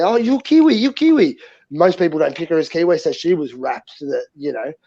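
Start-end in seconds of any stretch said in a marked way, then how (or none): 0.0-1.4 high, delighted mimicry of a Chinese man; imitated Chinese man. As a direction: imitate a Chinese man's happy recognition. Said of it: "he actually said".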